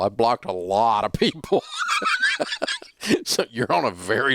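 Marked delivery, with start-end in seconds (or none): 1.7-2.8 amused high-pitched laugh; tickled laugh at own line. Real, not acted.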